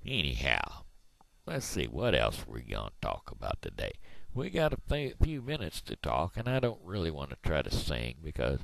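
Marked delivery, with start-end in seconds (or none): none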